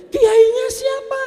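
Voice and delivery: very high, loud, disbelieving squeak; shrieking disbelief; incredulous wife. Acted. Wife hears husband's plan, very high astonished cry.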